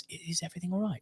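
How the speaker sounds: very soft, discreet check; hushed worried question. Retold or imitated. Dad discreetly asks the medics so his wife won't hear, hushed. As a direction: ask under your breath.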